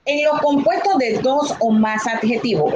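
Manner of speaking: usual speaking voice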